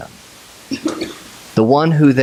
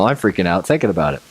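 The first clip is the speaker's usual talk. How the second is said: ordinary talk, nothing marked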